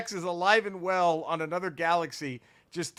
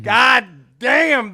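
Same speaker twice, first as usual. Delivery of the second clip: loud, drawn-out punch on each word; blown-away holler; excited exclamation; 5 semitones higher, 11 dB louder than usual. Real, not acted. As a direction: burst out with it, high and loud. Excited outburst over the episode.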